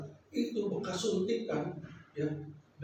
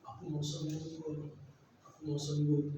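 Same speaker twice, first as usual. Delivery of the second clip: soft, low, subdued pleading; retold tearful plea; 5 semitones lower, 9 dB quieter than usual. Preacher retells sick friend crying in hospital, understated tearful plea. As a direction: quietly voice a crying patient's wish, understated. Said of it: "dia nangis".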